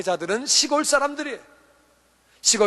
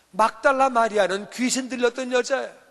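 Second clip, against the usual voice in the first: ordinary talk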